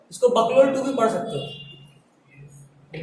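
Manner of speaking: joking nickname. Shown as a demonstration. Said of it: "बीएसीएल टू को हम बकलोल टू बोल रहे हैं".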